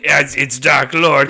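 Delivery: loud, fed-up correction; irritated Dark Lord. Acted. Satan corrects the demon, loud and fed up. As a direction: correct him yet again.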